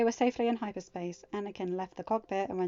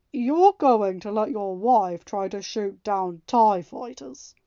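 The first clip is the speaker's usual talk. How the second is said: slow, loud, disbelieving question; can't believe what he hears; incredulous; 9 dB louder, slower than usual. A narrator's reading. Han doubting that Anakin's wife can shoot, slow incredulous question. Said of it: "Han asked incredulously".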